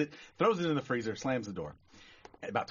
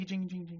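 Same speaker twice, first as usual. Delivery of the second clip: high, quick imitated bell; door bell jingle; 7 semitones higher, 6 dB quieter than usual. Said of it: "the door just jing".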